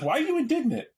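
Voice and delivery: high, incredulous question; mock puzzled question. Teller quoting herself to the offended man, high and incredulous. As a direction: pitch it way up in disbelief. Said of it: "And I was like".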